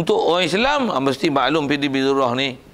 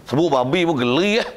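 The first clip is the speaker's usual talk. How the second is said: ordinary talk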